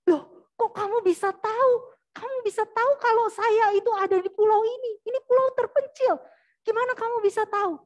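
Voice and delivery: high, loud disbelieving questions; astonished questioning. Retold or imitated. Castaway to the unexpected rescuer on a remote island, astonished. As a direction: demand an answer in amazement.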